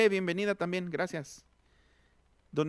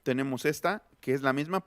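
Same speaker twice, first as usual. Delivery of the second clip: normal talking voice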